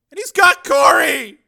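high, slow, loud cry of alarm; panicked shout. Acted. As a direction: shout the terrible news in horror. Trembling man reports a kidnapping.

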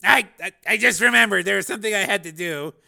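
nervous excuse to get away; man backing off nervously; flustered stammer. A narrator's reading. Nervous engineer flees.

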